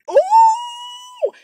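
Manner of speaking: very high, loud drawn-out "ooh"; retold "ooh". Retelling Chris coming out, a high loud whoop. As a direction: imitate a long high-pitched whoop. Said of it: "and he said".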